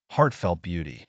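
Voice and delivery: usual speaking voice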